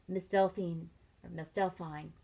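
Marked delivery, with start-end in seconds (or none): none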